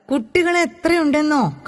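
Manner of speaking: put-on a boy bragging to his friend, excited; excited boast. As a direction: thrill over how many children. Boy boasting about town school.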